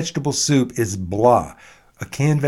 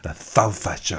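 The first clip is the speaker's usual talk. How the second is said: lisped Spanish-style "the salsa show", quieter; mock Catalan accent; 4 semitones lower, 4 dB quieter than usual. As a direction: say "salsa" as "thalfa" with a Catalan lisp. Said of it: "as they say in Catalan".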